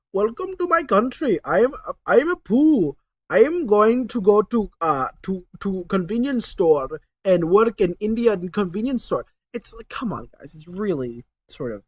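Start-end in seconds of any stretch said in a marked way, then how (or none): 0.1-9.3 a lightly put-on Indian accent, pitched up; playing Apu welcoming people to India, in a mock Indian accent. Mock Indian accent, guiding the family through India. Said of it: "he's like".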